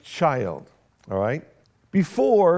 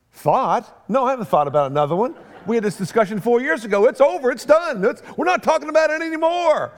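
rising to a much higher, louder mock rant; exasperated refusal; 6 semitones higher, 4 dB louder than usual. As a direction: build from irritation to a near shout. Retold refusal to wife.

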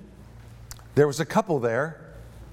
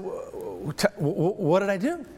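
stammering, then higher on the question; baffled stammer; 4 semitones higher than usual. Retold or imitated. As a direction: act out being caught off guard, tripping over the first word. Acts out his baffled reply.

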